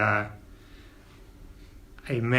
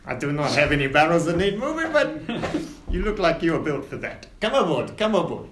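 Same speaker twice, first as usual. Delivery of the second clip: higher, grand ship captain; captain Winters; 3 semitones higher than usual. Acted. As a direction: welcome passengers aboard with flourish. Captain checks tickets.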